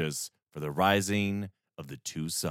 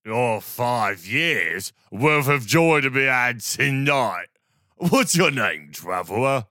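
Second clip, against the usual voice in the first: put-on town drunk; 3 semitones higher, 7 dB louder than usual. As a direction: slur it loud and merry. Drunk reveler speaks again.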